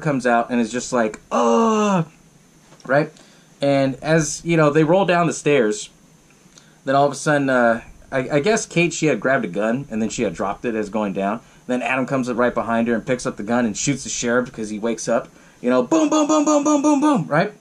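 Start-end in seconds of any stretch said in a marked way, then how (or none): 1.3-2.1 high drawn-out attacking "ugh"; mock killer's cry, pitched up; monster-like groan. Retold killer lunges out. 15.8-17.3 rapid high-pitched booms; string of punchy high "boom" noises; imitated gunshots.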